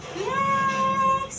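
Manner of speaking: a sky-high, stretched Flex. Shown as a demonstration. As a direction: hold Flex up high like a diva note. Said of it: "Mag-flex na tayo".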